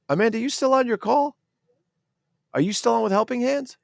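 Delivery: high-pitched call across the house. Real, not acted. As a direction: call out a question to someone off mic. Calls to Amanda off mic.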